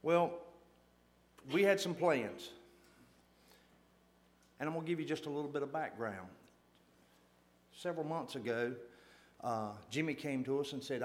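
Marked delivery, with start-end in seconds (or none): none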